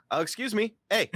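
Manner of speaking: mock a polite Canadian with a bad accent. Host doing a deliberately horrible Canadian accent. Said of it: "horrible, horrible accent".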